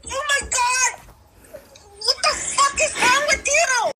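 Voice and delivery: ordinary delivery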